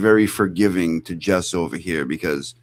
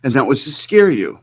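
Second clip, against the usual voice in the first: higher, mock-dramatic repeat of And that was to scare you; mocking imitation; 6 semitones higher than usual. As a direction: parrot it to ridicule him. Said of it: "Who talks like this?".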